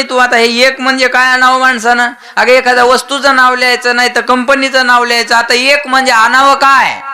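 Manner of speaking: high-pitched mock tirade; exasperated husband. Husband asking wife what "one" means, high exasperation. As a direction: argue it out, voice held high. Husband quarrels over wife's note.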